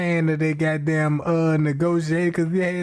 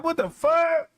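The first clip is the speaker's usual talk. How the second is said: high, startled exclamation; freaked-out what-the-hell; shocked outburst; 10 semitones higher than usual. Retold or imitated. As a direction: blurt it out in disbelief. Shocked reaction to hearing voices.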